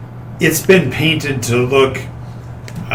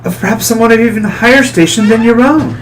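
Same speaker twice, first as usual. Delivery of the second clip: suspicious noblewoman; 3 semitones higher than usual. Acted. NPC lady suspicious.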